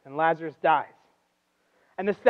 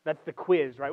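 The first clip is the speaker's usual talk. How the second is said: normal talking voice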